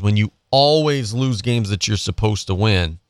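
usual speaking voice

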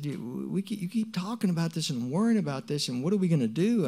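high-pitched, insistent complaint; retold exasperation. Telling them to stop worrying about this, exasperated. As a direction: press the point, pitch held high.

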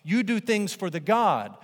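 high, loud insistence; insistent pagans. Retold or imitated. Pagans insisting to listeners, loud firm insistence. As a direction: correct them firmly and loudly. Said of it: "Everyone else on the earth thinks".

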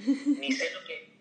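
embarrassed giggle at not knowing; laughing. Real, not acted. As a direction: laugh while confessing you didn't prepare.

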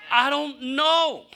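slow, drawn-out I don't know; helpless admission. Retold or imitated. Pastor to a friend with a sick sister, slow and helpless. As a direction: give up on the answer, stretching it out. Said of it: "I'm kinda going through it myself".

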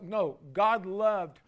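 ordinary talk

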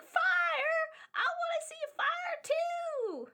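put-on very high, squeaky child clamour; excited kids. Other kids beg to see fire, excited high child voice. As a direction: pipe up like a thrilled little kid. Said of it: "all the other kids like".

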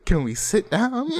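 slow, high-pitched whine for a seat; mock pleading. Tired guy at a show asking to sit, mock whine. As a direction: drag it out, comically needy. Mocks own wish to sit down.

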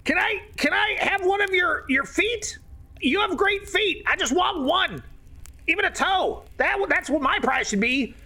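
high, wheedling goblin voice; high pleading redcap; creepy goblin. Acted. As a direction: beg for a toe like a weird little goblin. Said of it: "And the other one's like".

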